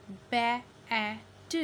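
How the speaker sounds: slow, separate phonics sounds in बैट; sounded-out short A. Shown as a demonstration. Teacher shows the short vowel, sounding out बैट. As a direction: break बैट into "ba - a - t". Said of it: "ए की आवाज़ आ रही है".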